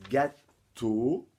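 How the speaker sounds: gâteau in two loud, separated syllables. Shown as a demonstration. Teacher shows pupils the rhyme, gâteau split loudly by syllable. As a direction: punch out gâteau, pausing before the final teau.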